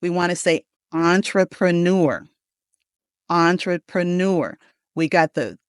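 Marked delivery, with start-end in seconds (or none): 0.9-2.3 "entrepreneur" as ON-truh-pruh-NUR; careful model "entrepreneur", French-style first vowel; "on" at the start. Shown as a demonstration. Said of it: "the beginning of the word is on instead of in". 3.3-4.6 "entrepreneur" slowly as ON-truh-pruh-NUR; slow, careful model "entrepreneur", opening with "on"; first and last stressed. Shown as a demonstration. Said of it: "the emphasis on the first syllable and the last syllable".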